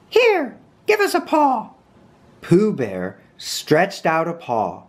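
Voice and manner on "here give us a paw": very high character voice; Rabbit. A narrator's reading. Rabbit answering his guest Pooh, very high voice. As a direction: pitch it way up, small and proper.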